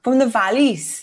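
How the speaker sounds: slow, tentative Welsh accent; attempted Welsh accent. Shown as a demonstration. Copying the co-host's demonstration, attempted Welsh accent. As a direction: copy a Welsh accent slowly. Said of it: "how do you say it".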